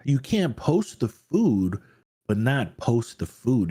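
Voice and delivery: slow, stressing "can't" and "not"; slow mock lecture; slow emphatic scolding. Real, not acted. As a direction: spell it out slowly. Scolding over food post.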